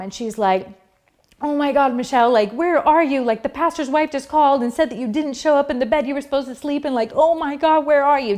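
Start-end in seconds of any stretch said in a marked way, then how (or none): 1.4-8.4 higher-pitched retold panic; worked-up mimicked phone message; frantic stepmom. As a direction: imitate a stepmom frantically demanding answers. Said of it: "this frantic phone call from my stepmom".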